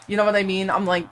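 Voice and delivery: ordinary talk, nothing marked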